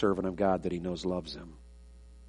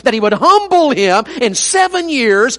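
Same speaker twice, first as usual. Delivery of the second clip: high, loud pulpit cry, slow at times; fiery revival preacher, raised and ringing; full-force preaching; 14 semitones higher, 11 dB louder, slower than usual. Acted. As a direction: preach it out to the back pew.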